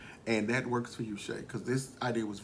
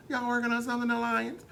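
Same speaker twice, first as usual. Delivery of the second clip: suspicious quote; 11 semitones higher than usual. Retold or imitated. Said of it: "Jinx suspicious".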